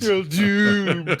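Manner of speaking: loud, slow, high doom cry; slow ominous raspy drawl. Retold or imitated. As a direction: wail the doom warning slowly.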